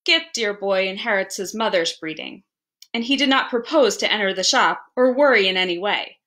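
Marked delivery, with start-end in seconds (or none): none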